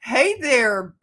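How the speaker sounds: ordinary talk, nothing marked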